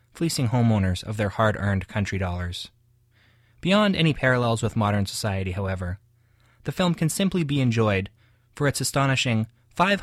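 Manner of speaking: loud, polished radio announcer reading a review; booming broadcast review voice. Acted. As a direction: present it like a radio host, crisp and loud. Radio review read, music at end.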